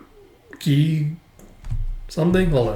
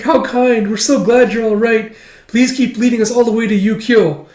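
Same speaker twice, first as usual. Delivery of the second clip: much higher, sugary soldier voice; fawning soldier voice; 9 semitones higher, 3 dB louder than usual. Retold or imitated. Soldiers praising Princess Yona in a high gushing voice. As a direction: gush over the princess.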